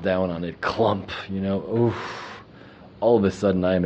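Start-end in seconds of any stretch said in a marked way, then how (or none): 1.8-2.4 tired oof. Retold or imitated. Flopping down exhausted on mat.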